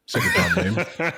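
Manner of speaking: high, slow motherly scolding voice; imitating mum. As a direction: play his mum telling him off. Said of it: "she'll be like".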